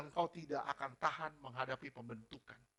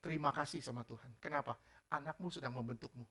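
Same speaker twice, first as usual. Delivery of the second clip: ordinary delivery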